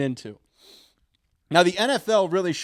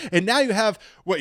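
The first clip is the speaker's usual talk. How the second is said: ordinary talk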